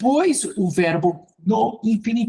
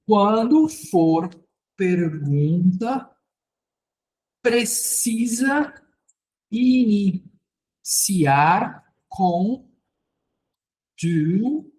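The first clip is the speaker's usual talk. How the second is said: drawn-out words with long pauses; slow dictation; much slower than usual. Real, not acted. Teacher writing out a rule for students, slow dictation. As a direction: spell the rule out word by word, slowly.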